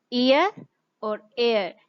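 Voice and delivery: slow, drawn-out ईयर and एयर, each vowel gliding; careful sound demo. Shown as a demonstration. Teacher showing students two close sounds, slow and careful. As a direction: stretch ईयर and एयर slowly so the difference shows. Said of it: "ये दोनों थोड़ी सी different है".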